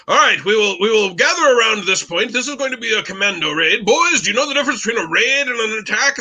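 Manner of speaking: brief the troops in a put-on British military accent; terrible British accent. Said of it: "I will do my terrible British accent".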